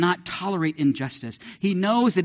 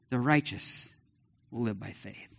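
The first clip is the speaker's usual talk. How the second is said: solemn; 3 semitones lower, 7 dB quieter than usual. Acted. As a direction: let the verse land. Key verse delivered solemnly.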